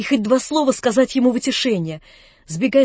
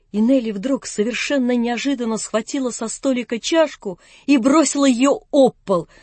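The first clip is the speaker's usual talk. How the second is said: plain reading voice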